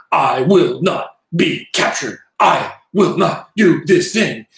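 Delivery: slow, staccato, each word its own sentence; William Shatner. Retold or imitated. Teacher imitating Shatner's broken delivery, slow word-by-word staccato. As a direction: break it up word by word like a starship captain. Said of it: "William Shatner used to speak in very broken".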